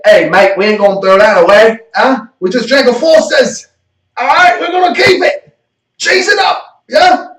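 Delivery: hammed-up shouty Australian accent; horrible Australian accent. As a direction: do a rowdy, over-the-top Australian mate, badly. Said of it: "a really horrible Australian accent".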